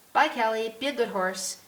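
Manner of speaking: put-on high, loud boy calling goodbye; young boy. A narrator's reading. Billy says goodbye to the horse, young boy's high call. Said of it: "Billy gives my nose one last rub".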